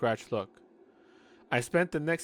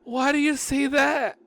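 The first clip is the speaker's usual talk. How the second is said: high character voice; 13 semitones higher, 8 dB louder than usual. Acted. Reading own narrator line.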